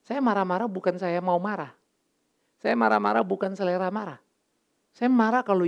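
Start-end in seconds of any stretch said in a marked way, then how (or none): none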